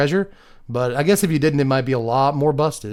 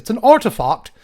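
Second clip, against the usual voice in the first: higher, louder mock British accent; 8 semitones higher, 5 dB louder than usual. Card reviewer hamming up artifact for viewers, mock British accent. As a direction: play up a sudden British accent. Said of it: "suddenly developed a British accent".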